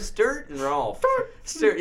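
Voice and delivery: high mock whine of the name, lightly voiced; imitating Stewart. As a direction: say the name like him, whiny. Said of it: "that's how he said it".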